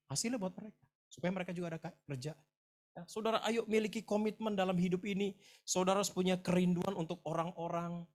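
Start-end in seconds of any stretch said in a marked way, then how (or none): 2.9-8.1 raised, louder preacher's voice; high, insistent pulpit appeal; preaching exhortation. Real, not acted. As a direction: urge the congregation on, pitched up.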